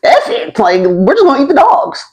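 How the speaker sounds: high exasperated blurt; fed-up outburst. Retold or imitated. Starving men deciding to eat the dogs, exasperated. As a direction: toss it off, giving up. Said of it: "they're like".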